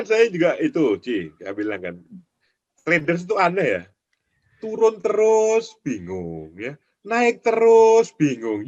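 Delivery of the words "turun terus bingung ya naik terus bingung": mock confusion. Mocking confused traders' complaints.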